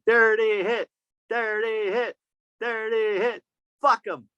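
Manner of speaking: mock chant of a fan; imitating Craig. Mimicking Craig yelling at the refs, a hint of chant. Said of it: "so this one's for Craig".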